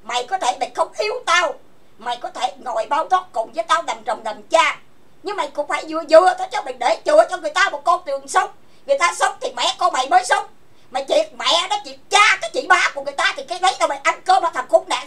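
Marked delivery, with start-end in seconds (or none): 5.2-15.1 higher, louder and fast angry tirade; furious yelling. Real, not acted. Scolding her ex for ruining her livelihood, fast furious yelling. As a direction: scream it at him, fast and enraged. Angry rant at former partner.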